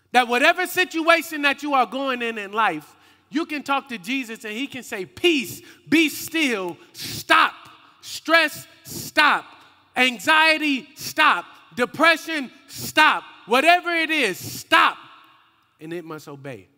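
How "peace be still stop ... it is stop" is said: loud, raised preaching shout; forceful command. Acted. Jesus commanding stress, anxiety and depression to stop, forceful command. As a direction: punch out each order like a rebuke.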